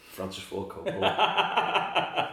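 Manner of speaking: slow, high, loud film credit read; mock epic credit announcement; grand credit voice. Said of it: "it should have just said Brando Wrangler".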